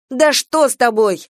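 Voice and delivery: loud angry bark. A narrator's reading. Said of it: "гаркнула Венка".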